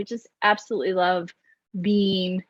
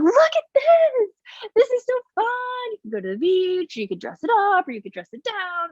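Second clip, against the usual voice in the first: much higher, gushing clothing seller; excited sales host; 11 semitones higher than usual. Retold or imitated. Clothing live sellers showing outfits, gushing high excitement. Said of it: "'cause they're like".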